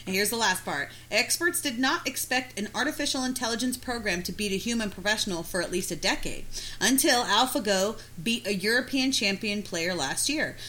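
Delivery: reading aloud. Acted. Reads article aloud.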